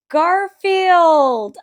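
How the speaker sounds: thrilled shout on pulling it out; excited exclaim. Real, not acted. Vlogger revealing her new Garfield lunchbox to viewers, excited shout.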